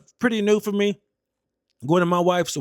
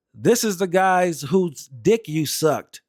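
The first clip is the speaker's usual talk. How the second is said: slow deliberate; slower than usual. Retold or imitated. Teller introduces captain to wife.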